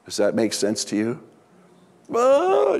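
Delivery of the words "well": very high imitated wail; imitating sudden floodgates of tears; mock bawling. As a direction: burst into a hammed-up, drawn-out cry. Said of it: "you know, just floodgates".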